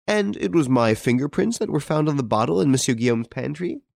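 mock disbelief. A narrator's reading. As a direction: scoff at the charge, voice lifted and rising at the end. Dutreuil laughs off the accusation.

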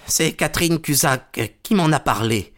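lightly hoarse, strained admission; a touch of huskiness from a cornered man. A narrator's reading. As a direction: confess it with a rasp in the throat. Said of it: "dit-il d'une voix rauque".

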